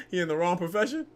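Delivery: high, breathless laugh into a teasing question; cracking up while mocking him. Real, not acted. Mocking fighter, bursts out laughing.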